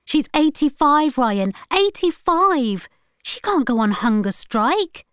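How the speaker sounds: high, indignant outburst; angry protest. A narrator's reading. Granddaughter defending her elderly grandmother, angry. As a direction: protest in sheer disbelief. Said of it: "asked Irie angrily".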